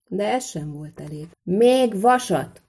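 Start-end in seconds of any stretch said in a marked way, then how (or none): 1.4-2.6 slow, high, drawn-out shout; loud shout. A narrator's reading. Smith shouting so those outside hear too, loud shout. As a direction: call it out loudly, stretching the words. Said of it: "kiáltotta Szűcs Lajos, jó hangosan".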